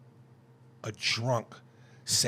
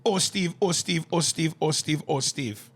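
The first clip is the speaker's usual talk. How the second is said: mock Jamaican accent, high start easing down; 4 semitones higher than usual. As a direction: repeat the name in a put-on Jamaican lilt. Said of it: "this is a play on that".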